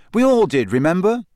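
Harry defending himself to Ron, impatient. A narrator's reading. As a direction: snap back impatiently. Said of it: "said Harry impatiently".

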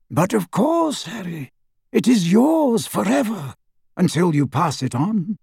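high-pitched, assuring headmaster; Dumbledore. A narrator's reading. Headmaster letting his pupil keep a present, high-pitched. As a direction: grant it with the voice lifted high.

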